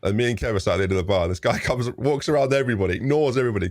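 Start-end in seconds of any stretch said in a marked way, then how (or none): none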